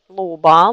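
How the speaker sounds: "loba" slow with a voiced B. Shown as a demonstration. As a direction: draw out "loba" and voice the B. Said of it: "ça devient le son normal".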